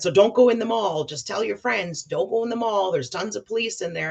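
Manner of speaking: lightly voiced Donald warning her; imitating Donald's warning. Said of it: "he said".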